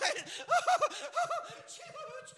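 high, hammed-up boo-hoo sobs; exaggerated comic weeping; mock crying. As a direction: fake the mourners' crying. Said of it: "and they're crying".

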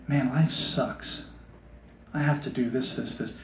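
soft, low, sagging complaint; mock self-pity. Victimized person listing duties, mock glum. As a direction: grumble it like a defeated victim.

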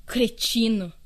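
loud insult spat out; furious exclamation; angry outburst. Acted. Outburst on hearing the news.

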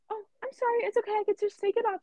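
high, appeasing cashier voice; placating apology. Retold or imitated. Cashier apologising to angry customer.

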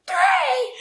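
high, loud outraged scream; imitating a child's shocked shout. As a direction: shriek it like a small child refusing. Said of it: "Like, screams it".

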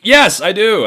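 loud, high, emphatic admission; retold outburst. Son answering dad about hatred in his heart, loud outburst. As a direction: fire back with voice jumping up. Snaps back at father's accusation.

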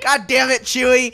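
loud high exasperated yell; mock exasperated yell. Cursed Star Wars quote, loud exasperated yell. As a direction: yell at Chewie in mock frustration.

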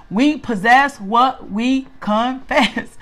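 each word set apart, very slow; slow, deliberate teaching. Real, not acted. Teacher giving the group a key saying, slow and measured.

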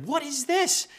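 high-pitched angry demand; angry outburst. Acted. Husband to wife over dried-out dinner, angry. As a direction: snap it in indignant disbelief, voice shooting up. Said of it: "you get so angry".

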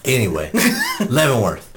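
normal talking voice

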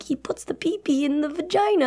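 a hint of an awkward parent explaining sex; mock parent voice. Parents giving the sex talk, mild mock parent voice. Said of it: "their sex talk, I think, was just like".

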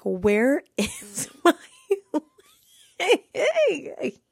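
slow words broken up by laughter; laughing too hard. Real, not acted. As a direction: voice climbing high as the laugh takes over. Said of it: "laughing this hard".

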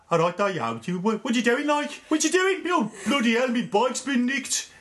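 a droopy put-on Birmingham whine; Birmingham accent. Shown as a demonstration. Asked for the Birmingham accent, he does a mock Brummie. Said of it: "What's the Birmingham accent?".

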